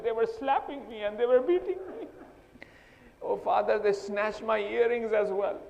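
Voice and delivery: lamenting daughter, rising to a wail; grieving lament. Retold or imitated. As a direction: cry out to the father in grief. Sakina's lament to father, rising.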